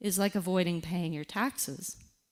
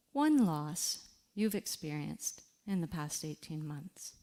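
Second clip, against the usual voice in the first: softer, unhurried reflection prompts with long pauses; guided reflection; 4 dB quieter than usual. Acted. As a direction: guide the listener gently through the moment. Leads a reflection exercise.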